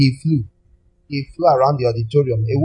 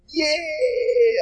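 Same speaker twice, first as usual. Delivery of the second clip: mock squeal of joy; 14 semitones higher, 5 dB quieter than usual. Mocking delighted crowd again.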